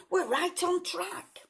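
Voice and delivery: high, slow, pleased thief; storybook thief. A narrator's reading. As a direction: draw it out like a crook sure of his route. Thief Hugh pleased with progress.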